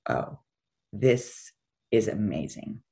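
soft, low and slow, awestruck; hushed awe. Retold or imitated. Driver alone on a snow-blanketed highway, hushed awe. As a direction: let it out quietly, in slow wonder. Retelling awed snowy morning drive.

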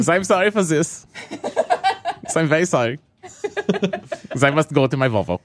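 slow, put-on European accent; foreign-doctor accent. Doctor in Germany apologizing to patient, comic European accent. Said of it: "is this a language barrier?".

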